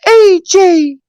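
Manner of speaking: squeaky high-pitched helium voice; chipmunk-high calling out. Pretend. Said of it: "it sound like you're on helium".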